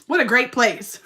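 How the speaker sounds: laughing through the words. Real, not acted. Laughing at her own gyno joke, loud and high. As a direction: crack up at your own joke.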